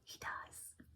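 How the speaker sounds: barely audible whisper; whispered aside. Real, not acted. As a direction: whisper it confidingly, almost to yourself. Aside about her son watching.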